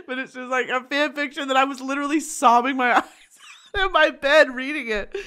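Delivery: high, loud, half laughing; laughing protest. Real, not acted. Reader defends sobbing over fan fiction, laughing. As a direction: protest through real laughter.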